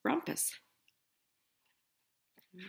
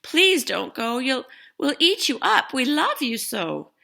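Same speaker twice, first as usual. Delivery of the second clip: wild things pleading; 7 semitones higher, 10 dB louder than usual. Acted. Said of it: "But the wild things cried".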